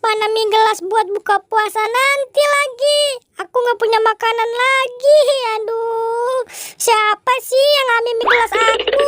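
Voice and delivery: high, squeaky cartoon voice, fretting; a frantic little creature who lost its snack; high squeaky voice, upset and whiny; high-pitched squeaky character.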